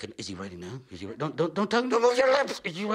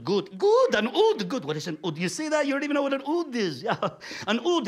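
ordinary delivery

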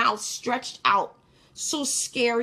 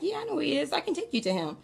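light, higher-pitched easygoing reply; offhand, acting out an easy yes; nonchalant; 7 semitones higher, 3 dB quieter, much faster than usual. Dreamer casually offering to lead a man to the boy, nonchalant. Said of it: "it was, like, nonchalant".

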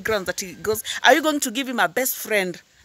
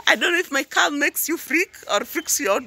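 higher voice with laughter left in it; amused, laughing; 4 semitones higher than usual. Real, not acted. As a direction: talk on while getting over a giggle. Amused by own claimed calm.

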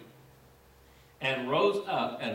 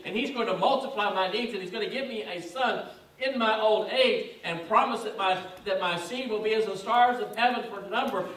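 higher, louder, building sermon voice; full-force preaching; 8 semitones higher, 4 dB louder than usual. Acted. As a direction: preach it out, lifting to the climax.

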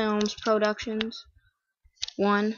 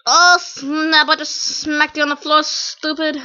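loud, higher mock trash talk; quoted video line; 4 semitones higher, 5 dB louder, slower than usual. Gamer quoting his own video, loud mock taunt. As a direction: throw out the line with hammed-up force. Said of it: "That was one of the lines".

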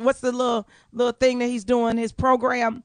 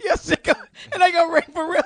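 normal talking voice

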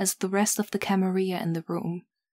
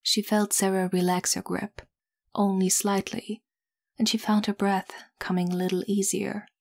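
ordinary delivery

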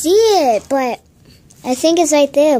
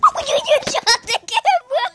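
high, breathless laughter; laughing; 9 semitones higher than usual. Real, not acted. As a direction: laugh through the words.

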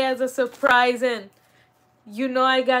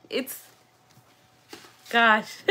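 ordinary delivery

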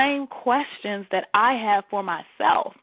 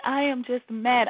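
ordinary delivery

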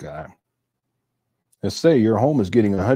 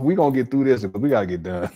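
ordinary talk

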